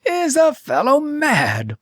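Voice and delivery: hammed-up furious monarch; bawling king. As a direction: bawl it in disbelief. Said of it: "bawled the king".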